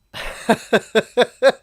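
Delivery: loud burst of laughter; a real loud laugh. Real, not acted. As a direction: laugh out in disbelief. Amused by the gift.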